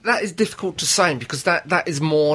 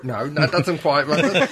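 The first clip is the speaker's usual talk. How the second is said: usual speaking voice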